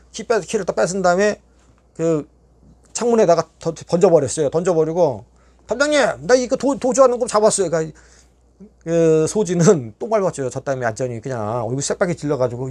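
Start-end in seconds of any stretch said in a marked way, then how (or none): 5.7-7.7 높이 치솟은 외침; 멀리 있는 교도관을 소리쳐 부르듯; 다급히 부르기. Retold or imitated. 교도관에게 도주범 잡았다 외침. (교도관에게 높고 다급하게 외침)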